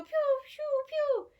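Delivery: squeaky high arrow pews; high pew sounds. A narrator's reading. Archers firing, high quick pew pew pew. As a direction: zap out little pew noises, high. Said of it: "these guys go".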